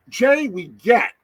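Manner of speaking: high, loud mock incredulity; teasing disbelief. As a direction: question a buddy in exaggerated disbelief. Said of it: "I'm like".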